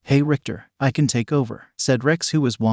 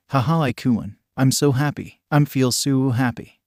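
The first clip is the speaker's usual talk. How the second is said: ordinary delivery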